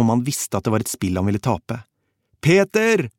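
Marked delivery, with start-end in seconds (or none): 2.4-3.1 high, loud cry for help; desperate scream. A narrator's reading. Drowning man to his comrade, high desperate scream. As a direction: scream the name across rushing water. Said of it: "skrek han".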